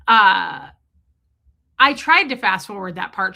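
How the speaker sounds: ordinary delivery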